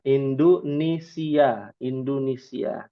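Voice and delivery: Indonesia drawn out as indunesia; slow indunisia for the class; slow Arabic pronunciation. Shown as a demonstration.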